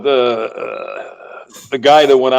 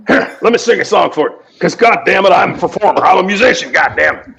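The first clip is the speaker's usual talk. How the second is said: Rusty impression; 4 semitones higher than usual. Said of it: "Here's Rusty".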